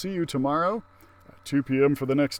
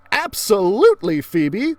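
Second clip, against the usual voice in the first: cheerful teacher voice; 6 semitones higher, 8 dB louder than usual. Acted. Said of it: "the Frizz said".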